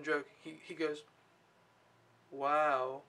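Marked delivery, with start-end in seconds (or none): 2.3-3.0 drawn-out, level wow; awed child. Retold or imitated. Nanny retelling the boy's reaction to books, mild awe. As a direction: lightly imitate a kid's slow wow. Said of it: "his reaction was literally".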